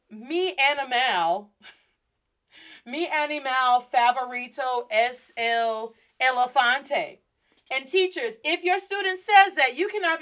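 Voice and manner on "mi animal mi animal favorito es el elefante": put-on heavy American-accented Spanish, dragged out; slow, badly pronounced learner's Spanish; mispronounced Spanish. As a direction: say animal and elefante with English vowels, slowly. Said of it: "That's mal pronunciado".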